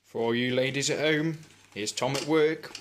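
loud, showy announcer voice; mock presenter. Friend announces Tom's whisking to ladies at home, hammed-up presenter.

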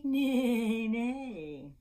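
long, loud playful neighing; whinny the word, drawn out; horse neigh. Pretend. Storyteller voicing the blue horse, playful neigh.

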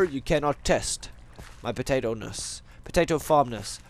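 ordinary talk

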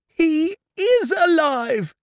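very high, slow, drawn-out cry; joyful shriek. A narrator's reading. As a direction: shriek the news out high, stretching each word. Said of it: "shrieked Dot".

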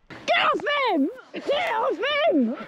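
high, drawn-out urgent cries; frantic, stretched-out high shout; panicked yelling. Real, not acted. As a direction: yell to break up a real fight. Bystander yelling during a fight.

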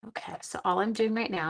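normal talking voice

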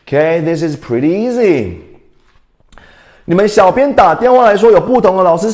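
ordinary talk

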